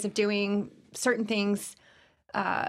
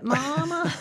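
put-on Southern accent; 6 semitones higher than usual. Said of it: "talking to my family of origin".

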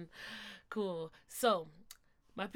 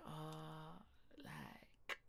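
hushed, much lower whisper; whispered confession; 4 semitones lower, 16 dB quieter, much slower than usual. Retold or imitated. Telling her best friend she's pregnant in a whisper. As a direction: let it slip out in a scared whisper.

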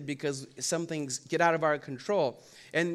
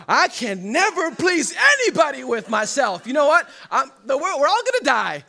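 loud, higher mock rant; mock outburst; 8 semitones higher, 9 dB louder than usual. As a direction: blow up in exaggerated despair. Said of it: "I'm like".

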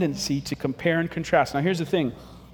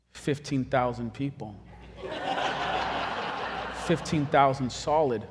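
deadpan brag breaking into laughter; 6 dB quieter than usual. Retold or imitated. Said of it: "keep a straight face".

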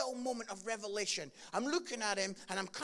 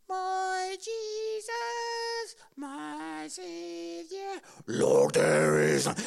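voice breaking; 9 semitones higher, 7 dB louder, much slower than usual. Retold or imitated. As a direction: sing like a boy whose voice is breaking mid-song. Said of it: "His voice broke during the song".

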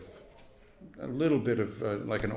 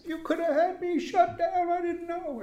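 much higher, slower, startled bartender voice; flustered bartender; 3 dB louder, slower than usual. Retold or imitated. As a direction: squeak it out like a caught barman. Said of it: "the guy says".

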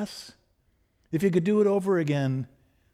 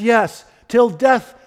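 fervent bride's vow; 7 semitones higher, 8 dB louder, slower than usual. Acted. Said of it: "she says".

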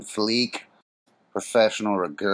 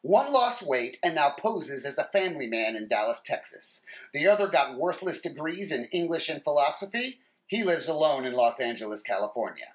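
mock-serious documentary narrator voice; announcer intro; 3 semitones higher than usual. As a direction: read it like a true-crime show opener. Scripted show intro read.

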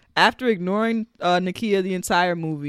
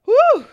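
high loud release shout; cathartic whoop; 9 dB louder than usual. Real, not acted. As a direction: shout to shake off tears. Getting emotion out.

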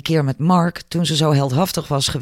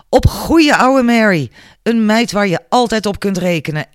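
cheering toast; 5 semitones higher, 4 dB louder than usual. A narrator's reading. Pub crowd toasting Mary, loud cheer. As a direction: raise a glass and cheer.

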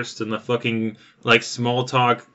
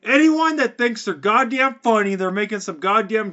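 angry; 9 semitones higher, 3 dB louder than usual. Real, not acted. Angry rant.

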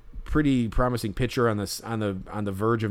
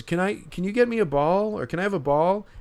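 imitating a kid asking; 8 semitones higher than usual. Fan retelling a kid begging a pitcher for a ball. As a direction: mimic a hopeful little kid. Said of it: "the kid says".

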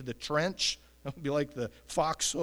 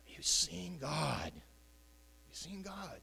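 slow question fading to near whisper; hushed question; 3 semitones higher, 5 dB quieter, much slower than usual. Acted. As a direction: ask it slowly, then much softer on the repeat. David's calm answer to panicked men.

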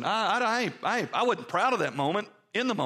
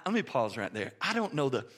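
ordinary talk

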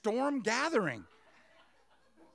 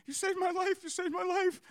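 much higher, tearful mock sob; retold sobbing; 13 semitones higher than usual. Shaken girl thanking the driver, high imitated sobbing. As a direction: squeak it out through imitated tears. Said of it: "She was sobbing".

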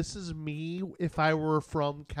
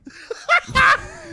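loud high laugh; 9 dB louder than usual. Real, not acted. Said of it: "It's Ace Ventura!".

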